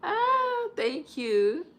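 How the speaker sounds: high, loud thank-you; delighted thanks. Real, not acted. Vlogger thanking a viewer who praised her eyes. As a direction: gush with thanks.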